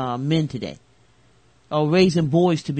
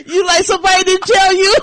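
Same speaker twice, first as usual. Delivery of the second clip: laughing shout; 14 semitones higher, 8 dB louder than usual. Real, not acted. Laughing shout at startled cohost.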